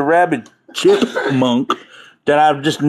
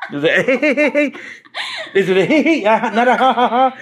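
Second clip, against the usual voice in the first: trying a laugh; 7 semitones higher, slower than usual. Shown as a demonstration. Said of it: "I don't know how to do New Orleans laughs".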